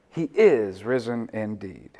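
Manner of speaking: low, measured response leaning on "is"; stress on IS. Shown as a demonstration. Preacher answers the Easter proclamation, stress on IS. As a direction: lean on "is", then settle down on "indeed". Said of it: "we should speak like it".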